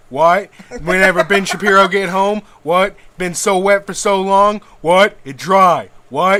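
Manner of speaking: loud, swaggering wrestler promo with shouted what? after every line; Stone Cold impression. Podcaster riffs as Stone Cold about Ben Shapiro's wife, loud wrestler promo. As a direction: bark the promo like a beer-chugging wrestler, yelling what? on each pause. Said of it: "it's hard to get a real Stone Cold tangent".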